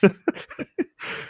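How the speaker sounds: airy, high giggle; breathy high laugh. Real, not acted. Laughing at the friend's fake rage quit, airy giggle.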